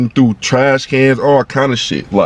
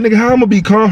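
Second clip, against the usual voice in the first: yelling in disbelief that he could calm down; panicked yell; 9 semitones higher, much faster than usual. Retold or imitated. Shot-at guy yells at the girl calming him. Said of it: "Panicking like a motherfucker".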